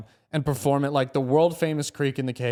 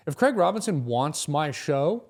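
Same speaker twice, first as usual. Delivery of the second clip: ordinary talk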